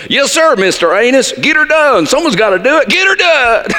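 high, loud mock-eager yes-man; mock yes-sir. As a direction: play the overeager servant for laughs. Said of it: "And I say".

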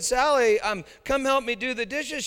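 high, loud grandma call. Retold or imitated. Grandma calling Sally to come do the dishes, high loud call. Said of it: "So when Grandma said".

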